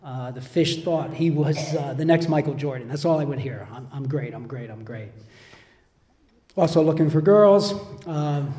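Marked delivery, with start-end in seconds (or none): 3.7-5.1 low, hushed imitation of a boaster; self-praise under the breath. Friend boasting about his basketball, imitated in a low mumble. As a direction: let the boast sink away softly.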